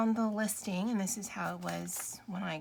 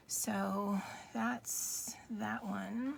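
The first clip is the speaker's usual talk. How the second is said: whisper; 6 dB quieter, much slower than usual. Real, not acted. Whispered aside, unvoiced.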